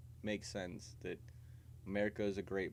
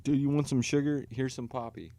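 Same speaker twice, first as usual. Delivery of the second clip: mock dealer offer; 6 semitones higher, 9 dB louder than usual. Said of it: "It's like".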